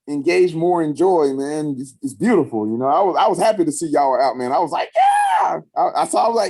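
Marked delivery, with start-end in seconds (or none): 4.9-5.6 high, whooping shout; re-enacted squeal of delight; joyful cheer. Cheering on friends seen out having fun, excited shout.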